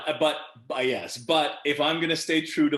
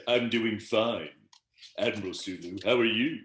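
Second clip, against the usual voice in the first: George Takei as Sulu. Acted. Actor answers Sulu in Sulu's own voice, impersonated. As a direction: answer in a measured, put-on admiral's voice. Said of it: "but me doing Sulu's voice".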